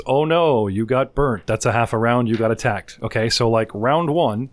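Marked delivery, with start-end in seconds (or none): none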